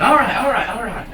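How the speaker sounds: high, loud burst of joy; happy. Acted. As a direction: cheer it out in quick repeats. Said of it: "made them animals so happy".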